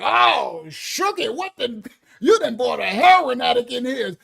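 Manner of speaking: loud shocked grandma. Retold or imitated. Grandma sees the heroin-chic girlfriend, loud shock. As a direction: blurt it out like a scandalized grandma. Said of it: "My grandma be like".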